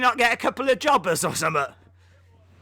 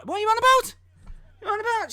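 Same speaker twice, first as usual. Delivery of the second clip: high, indignant retort; mock indignation; 10 semitones higher, much faster than usual. Streamer protests at chat, playfully indignant. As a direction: bristle with hammed-up outrage.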